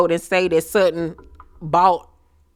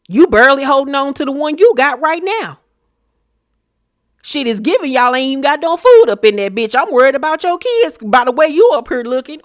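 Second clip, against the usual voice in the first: loud, fast, higher mocking read; pretend roast; 5 semitones higher, 4 dB louder, faster than usual. Mocking Dorit loudly, fast and higher. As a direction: rattle off the insults, mocking.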